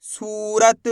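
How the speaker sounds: सूरत with the ऊ held long; drawn-out long ू. Shown as a demonstration. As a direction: stretch the सू in सूरत, then finish रत. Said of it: "खींच के पढ़ा जाता है".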